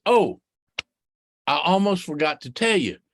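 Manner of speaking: ordinary delivery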